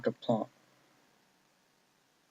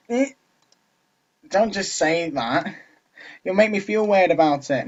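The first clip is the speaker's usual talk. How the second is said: higher, protesting character voice; flustered game character; 6 semitones higher, 4 dB louder, slower than usual. Acted. Natsuki objects to the player's remark, flustered.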